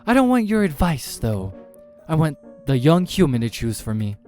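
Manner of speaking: high, loud demand; imperious demon. Acted. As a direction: overrule him loudly. Demon rejects his advice.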